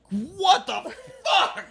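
sudden high-pitched disbelief; shocked exclamation. Retold or imitated. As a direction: blurt it out in shock. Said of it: "It was like".

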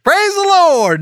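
high, loud praise shout; whooping, full-voiced command; exultant cry. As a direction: holler it high to spur on the horse. Preacher urges horse to gallop.

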